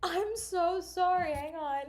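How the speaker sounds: sudden high cry of dismay, slowing on the apology; real distress. Real, not acted. Vlogger reacting to bad email, real dismay. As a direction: gasp it out, stunned and upset.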